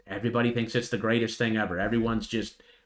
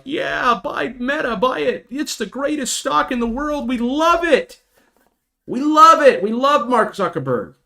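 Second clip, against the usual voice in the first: mock euphoric buyer; 13 semitones higher, 5 dB louder, slower than usual. Mocking euphoric Meta buyers.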